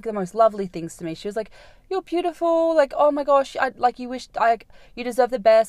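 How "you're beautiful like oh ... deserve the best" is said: gushing compliments. Retold or imitated. Imitating gushing drunk girl.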